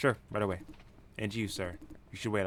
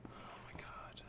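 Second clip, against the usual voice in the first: whisper; 19 dB quieter, much slower than usual. Acted. Stunned reaction.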